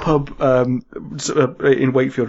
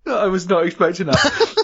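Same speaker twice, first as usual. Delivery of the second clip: laughing; 8 semitones higher than usual. Real, not acted. Returns after the connection drops.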